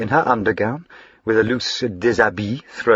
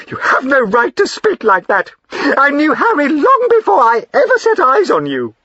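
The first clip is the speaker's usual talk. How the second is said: much higher and louder, indignant; defiant; 7 dB louder than usual. Acted. Wife flinging away from her husband, indignant defiance. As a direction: throw it at him in defiance.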